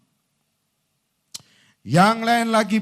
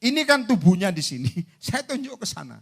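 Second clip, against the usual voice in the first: ordinary talk, nothing marked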